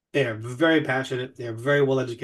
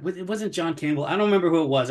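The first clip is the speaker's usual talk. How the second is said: ordinary talk, nothing marked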